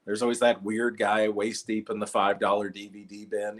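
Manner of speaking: usual speaking voice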